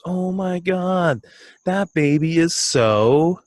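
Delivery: high, slow, gushing coo; imitating a coworker cooing at a baby photo; gushing over baby. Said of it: "And she goes".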